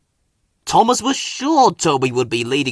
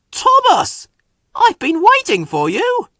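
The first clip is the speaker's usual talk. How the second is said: much higher, louder impatient Toby; a puffing complaint; huffed; 6 dB louder than usual. A narrator's reading. Toby greeting Thomas, huffy and high. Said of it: "Toby huffed".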